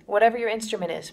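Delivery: normal talking voice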